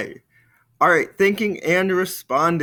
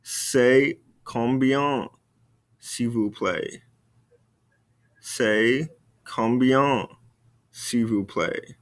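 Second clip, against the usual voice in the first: crawl through it so they can follow; very slow drill; much slower than usual. Shown as a demonstration. Teacher repeating the phrase very slowly for the class. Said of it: "Répétez-moi".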